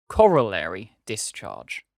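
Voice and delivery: "corollary discharge" spoken slowly and deliberately; slow, careful "corollary discharge"; correct pronunciation. Shown as a demonstration. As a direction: announce "corollary discharge" as the official correct form. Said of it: "Please pronounce this correctly".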